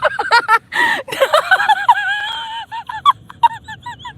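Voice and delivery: high shrieking laugh burst; real laughing fit; helpless cackling laugh. Real, not acted.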